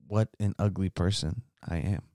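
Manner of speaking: normal talking voice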